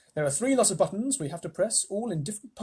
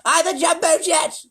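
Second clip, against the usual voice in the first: gleeful cackle; 8 dB louder than usual. Acted. Gleeful grandma flying.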